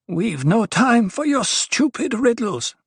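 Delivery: high, slow, irritated rebuke; growled. A narrator's reading. Duri is fed up with the dwarf's riddles, growling. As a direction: cut off the riddler, pitched high and drawn out. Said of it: "Duri growled".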